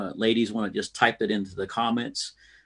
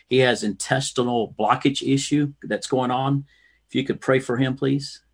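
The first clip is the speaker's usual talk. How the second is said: ordinary talk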